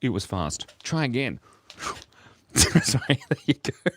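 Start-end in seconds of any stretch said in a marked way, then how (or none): 2.6-3.9 high words through laughter; giggly apology. Real, not acted. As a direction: apologise while cracking up. Laughing at own sound effect.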